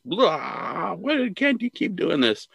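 high, slow, drawn-out exasperated wail; mock meltdown. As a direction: lose it like a frazzled editor. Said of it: "losing his shit".

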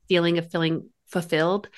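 real Utah accent slipping out. Real, not acted. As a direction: let your Utah accent come through. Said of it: "my Utah accent was coming, like, on full strong".

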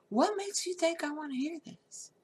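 high, indignant retort to the groom; angry retort. Retold or imitated. Bride to groom's drunken story, angry high retort. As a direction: snap it back, fed up. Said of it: "I was so angry".

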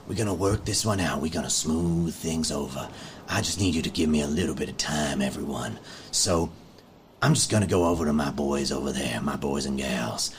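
calm the crowd like a trusted dad figure; calm leader. Acted. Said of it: "he's just like".

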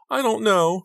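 very high, loud wail. A narrator's reading. Rachel asked how the breakup happened, wailing. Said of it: "she wailed".

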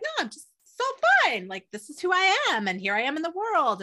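high-pitched breezy self-assurance; lifted, acted 'this is me' confidence; carefree confidence. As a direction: act out someone happily sure of themselves. Voices carefree confident Leo attitude.

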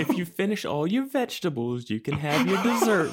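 high, sing-song mom voice with a laugh; high mock-mom voice, laughing; mock parent. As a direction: bribe a child in a high, sugary voice. Parent bargaining with a child.

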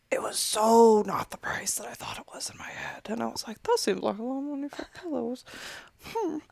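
soft, half-whispered inner voice; hushed doubt. Retold or imitated. Shopper privately doubting the total at the till, hushed doubt. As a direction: mutter it privately under your breath.

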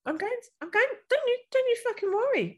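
squeaky high insistence; high mock-indignant promise; high-pitched reassurance. Real, not acted. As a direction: pitch right up, half-joking. Reassuring friend she will use it.

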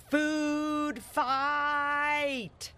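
high, drawn-out battle cry; booming yell. Acted. Cody starts chaos at the table, booming yell. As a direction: stretch both words into a ringing call. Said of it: "yelled in a booming voice".

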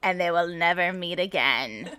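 low, soft storyteller voice; deep, quiet storybook ending; mock dramatic narration. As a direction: narrate a tragic romance with hushed gravity.

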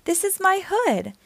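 high, bright exclaim of homecoming; tree overjoyed to see its hill; happy cry. A narrator's reading. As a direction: call it out, thrilled to be home. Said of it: "cried happily".

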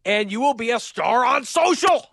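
high-pitched, over-the-top announcer payoff; hammed-up hype. Host promising customers online fame, hammed-up hype. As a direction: build to a big excited finish. Hyped-up sponsor read climax.

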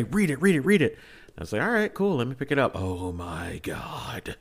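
ordinary delivery

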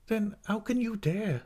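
high, slow, wounded challenge; tearful protest. A narrator's reading. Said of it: "both in tears and both talking".

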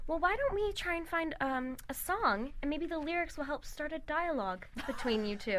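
soft, lightly imitated character line; hushed mock-earnest suggestion; Gwyneth. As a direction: quote it quietly and haltingly, as a gentle parody. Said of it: "Gwyneth goes".

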